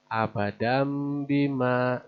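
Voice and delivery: "abadam bima" slow, with m; iqlab reading. Shown as a demonstration. Teacher models the reading for learners. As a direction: recite "abadam bima" slowly. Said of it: "menjadi mim".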